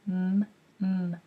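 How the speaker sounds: isolated "M, m" hummed with closed lips before the word; repeated first sound. Shown as a demonstration. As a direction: hum "M, m" with lips together, then stop. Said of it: "I might even repeat the first sound".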